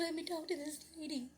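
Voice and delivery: high-pitched, choked-up retelling; retold tearful request. Mother asking son for the lady, tearful. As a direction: ask as a mother fighting tears. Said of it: "she was just bawling".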